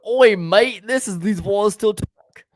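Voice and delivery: put-on hammy Australian accent; Aussie voice, high and loud. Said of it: "did you stutter in your accent".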